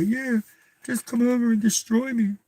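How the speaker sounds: high, breezy mock witch voice. Mocking the witch in an airy high voice. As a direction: play a carefree witch inviting her doom. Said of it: "no reasonable witch would say".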